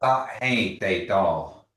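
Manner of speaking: Sa He Te Do, slow, each syllable apart; staccato. Shown as a demonstration. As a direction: sound out Sa He Te Do slowly. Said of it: "one word at a time".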